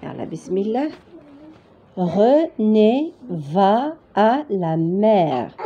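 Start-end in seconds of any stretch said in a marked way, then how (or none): 2.0-5.5 slow, drawn-out reading word by word; slow dictation. Shown as a demonstration. French teacher reading out the sentence for beginners, slowly. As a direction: read it slowly, holding each syllable.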